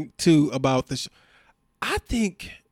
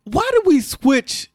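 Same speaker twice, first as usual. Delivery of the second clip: baffled; 11 semitones higher, slower than usual. Real, not acted. Confused outburst at the show.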